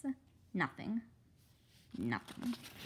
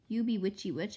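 ordinary delivery

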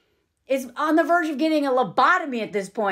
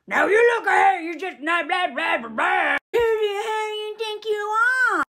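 mock furious Frank; 7 semitones higher than usual. Husband screaming at the neighbor, mock shout. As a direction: ham up the husband's outburst. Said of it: "This is when Frank loses it".

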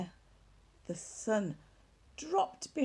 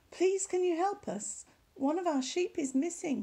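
put-on pleading boy, pitched higher; begging; 8 semitones higher than usual. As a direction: beg the neighbour for help. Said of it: "he begged".

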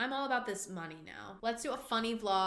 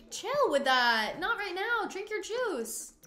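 high, drawn-out pleading scold; high-pitched exasperated nagging; scolding her character; 10 semitones higher, 5 dB louder, slower than usual. Pretend. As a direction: squeal it slowly at a misbehaving Sim.

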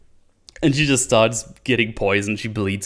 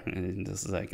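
ordinary delivery

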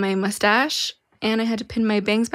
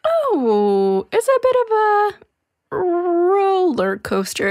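dramatic; 9 semitones higher, slower than usual. Acted. Dramatic build about her art.

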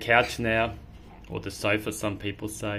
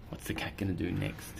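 ordinary delivery, nothing marked

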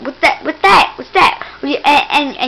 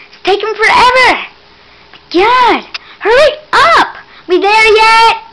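high, loud whiny complaint; real impatient nagging; impatient whine; 9 semitones higher, 4 dB louder than usual. Real, not acted. Impatient on long stairs.

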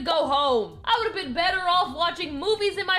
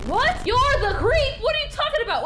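shocked disbelief; 7 semitones higher than usual. Acted. Shocked at the accusation.

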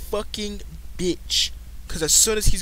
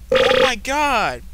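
loud, drawn-out mock scream; acted scream; 4 semitones higher, 7 dB louder, much slower than usual. Gamer acting out the father's scream, loud and hammed-up. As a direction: scream it out slowly, pitched up. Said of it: "Your father screams".

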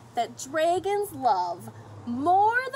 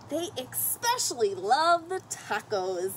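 ordinary delivery, nothing marked

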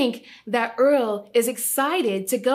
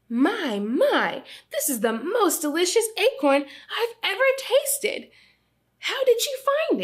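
delighted mother; 8 semitones higher than usual. Acted. Mother marvels at the acorn.

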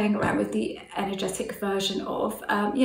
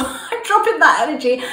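laughing; 6 semitones higher, 7 dB louder than usual. Real, not acted. Laughing declaration.

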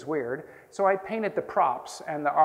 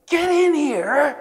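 high, loud and slow full yell; bigger retold yell; 13 semitones higher, 11 dB louder, much slower than usual. Teacher repeats his order to the stunned stranger, bellowing. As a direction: stretch the order out like an angry bellow.

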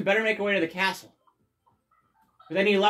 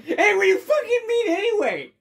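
mock hurt character; 13 semitones higher, 5 dB louder than usual.

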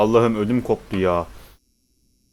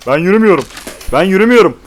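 louder and higher, building; frustrated shout; 6 semitones higher, 8 dB louder, faster than usual. Real, not acted. Repeats the same words louder.

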